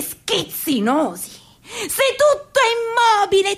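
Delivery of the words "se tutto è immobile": voice breaking. Acted. Dramatic monologue breaking voice.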